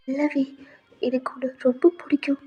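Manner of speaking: put-on very soft, whispery girl's voice; timid whispered confession. Alia confessing to V, timid whisper. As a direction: confess it almost under the breath. Shy girl confesses her liking.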